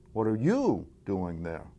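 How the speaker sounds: single out "you" with a swoop in pitch; stress on YOU. Shown as a demonstration. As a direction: lean slowly on the word "you". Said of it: "demonstrate five different meanings".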